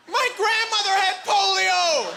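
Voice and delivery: high, loud, slow shocked cry; horrified outburst. Retold or imitated. Buddy horrified at the accusation, high shocked shout. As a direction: blurt it out in pale horror. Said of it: "he goes".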